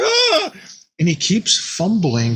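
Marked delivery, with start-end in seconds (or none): 0.0-0.5 a high mock yelp of alarm; mock scream. Tony yelping as the monster crashes through, high mock yelp. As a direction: yelp like he's just been caught. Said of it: "And he's like".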